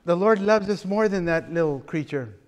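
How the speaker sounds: ordinary talk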